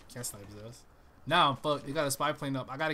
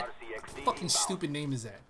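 ordinary talk

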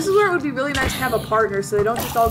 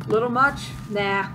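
ordinary delivery

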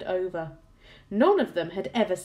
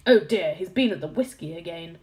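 ordinary delivery

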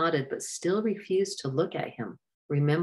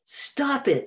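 a much higher yell; 11 semitones higher than usual. Acted. Sydney to the fighting boys, a high yell. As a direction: cry out to break up the fight. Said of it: "Sydney yelled".

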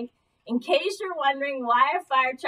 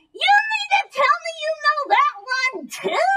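much higher, louder, fast astonished cry; exaggerated amazement; 13 semitones higher, 5 dB louder than usual. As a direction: overplay the disbelief. Ventriloquist feigns amazement.

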